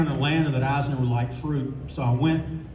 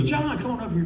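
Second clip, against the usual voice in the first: imitating Eisner calling; 8 semitones higher than usual. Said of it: "And he would go".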